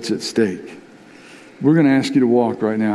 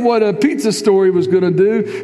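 full-force preaching; 9 semitones higher than usual. Acted. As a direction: preach it, sweeping the church along. Preaching climax after the quote.

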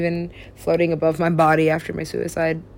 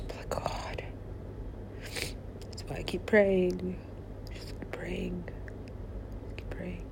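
very soft, unvoiced whisper; whispered prayer; 15 dB quieter than usual. Real, not acted. Speaker turning to God to keep praying, whispering. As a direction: fade to a hushed, private whisper.

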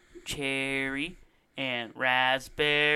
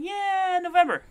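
mock high voice; 5 dB louder than usual. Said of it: "they could just be like".

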